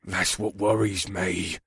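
low, soft, slow retort. A narrator's reading. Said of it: "Zuag retorted".

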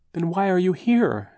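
high, nervous fussy aunt; anxious aunt. A narrator's reading. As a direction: ask like a flustered aunt. Aunt Josephine asks.

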